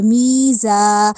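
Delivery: میزا slow with mee drawn out; long meem. Shown as a demonstration. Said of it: "میم کو کیوں لمبا کیا".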